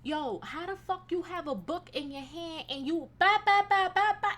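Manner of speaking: mocking chatter. Mocking the chatty woman at the pool, mock babble.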